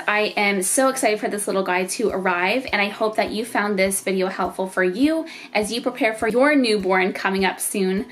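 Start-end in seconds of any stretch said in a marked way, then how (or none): none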